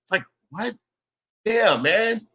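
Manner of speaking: ordinary delivery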